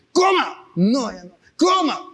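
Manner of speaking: high, pushy command to eat; insistent supervisor. Retold or imitated. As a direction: jab the order up high.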